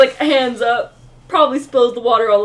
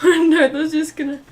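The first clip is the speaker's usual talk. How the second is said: ordinary talk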